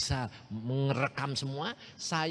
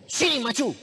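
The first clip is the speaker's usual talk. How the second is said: teacher's order; 9 semitones higher, 6 dB louder than usual. Retold or imitated. Teacher calls pupil forward.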